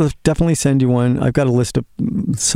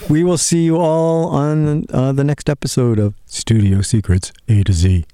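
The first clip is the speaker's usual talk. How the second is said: slow, drawn-out show sign-off; announcer voice; 2 semitones higher than usual. Pretend. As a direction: announce the show's end. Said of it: "I like your announcer voice".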